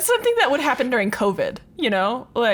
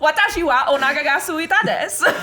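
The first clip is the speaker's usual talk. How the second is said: higher mock Southern twang on Japanese; Southern-accented Japanese; 4 semitones higher, faster than usual. Co-host mimics a TikTok woman's bits, Southern-accented Japanese. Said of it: "speaking Japanese in a Southern accent".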